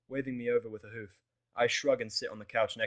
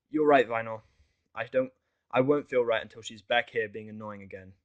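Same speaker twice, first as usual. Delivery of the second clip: determined; 8 dB louder than usual. A narrator's reading. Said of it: "with determination in my voice".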